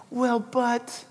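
soft, small-voiced hedging objection; imagined objection. Preacher voicing the congregation's unspoken doubt, soft mock objection. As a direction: voice the listener's silent doubt, softly. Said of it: "you had a but in your mind".

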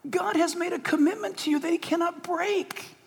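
quiet, fervent reassurance; hushed assurance. Real, not acted. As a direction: hush it with earnest conviction. Solemn assurance after imagined disasters.

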